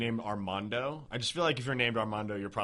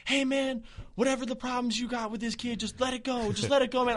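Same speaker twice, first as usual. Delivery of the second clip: much higher mock voice of a passing dude; high put-on voice of a guy across the road; imitating a bystander; 11 semitones higher than usual. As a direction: pitch up to play the stranger calming things down. Bystander urging him to stop.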